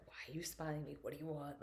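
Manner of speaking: a hint of wary strangers; suspicious locals. Voicing suspicious Czechs.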